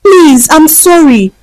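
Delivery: pleading for mercy. A narrator's reading. Vanessa clinging to her ankles, begging for mercy. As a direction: beg for forgiveness. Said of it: "pleaded for mercy".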